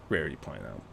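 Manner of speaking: ordinary delivery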